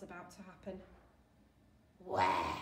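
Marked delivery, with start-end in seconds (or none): 2.0-2.6 very low, loud growled demand; granite-scraping voice. A narrator's reading. Looming sailor to the attendant, deep scraping demand. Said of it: "voice that sounds like two slabs of wet granite scraping".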